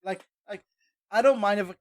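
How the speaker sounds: ordinary talk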